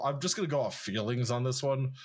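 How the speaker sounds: ordinary talk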